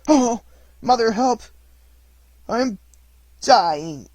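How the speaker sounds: high, loud desperate cry; dying cry. A narrator's reading. Struck boy calling for his mother, high and loud. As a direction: cry out as you collapse.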